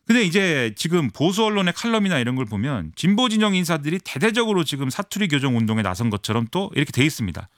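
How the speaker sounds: ordinary talk, nothing marked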